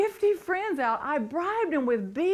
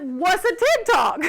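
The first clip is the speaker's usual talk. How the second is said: loud, high, slow mock bafflement; hammed-up puzzled friend, played for laughs; imitated clueless girlfriend; 7 semitones higher, 10 dB louder than usual.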